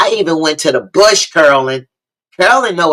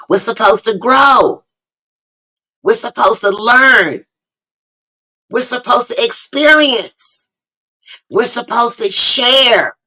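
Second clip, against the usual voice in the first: rising preacher's call, higher each line; preaching crescendo; 7 semitones higher than usual. Acted. Host preaching to her guests, building high. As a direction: lift each line up like a sermon.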